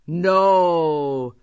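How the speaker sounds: drawn-out monster refusal; monster's cry. Acted. Biggest tokebi defying the farmer, monster's cry. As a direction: refuse like a big creature. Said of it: "cried the biggest tokebi".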